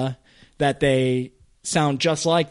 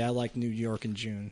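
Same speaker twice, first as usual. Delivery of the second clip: soft demo line; 4 semitones lower, 10 dB quieter than usual. Shown as a demonstration. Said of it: "pretend you're talking to your dad".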